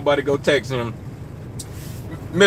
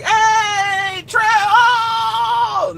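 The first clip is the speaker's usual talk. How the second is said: excited street greeting; much slower than usual. Retold or imitated. Fans on the street calling out to him, excited shout. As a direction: shout his name like spotting a celebrity. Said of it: "be like".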